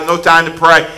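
usual speaking voice

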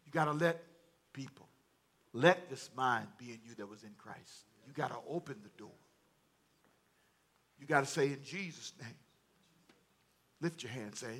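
low, soft prayerful confession; subdued, intimate plea from the pulpit; hushed preaching. Real, not acted. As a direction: let it sink quietly, with long pauses. Hushed altar appeal.

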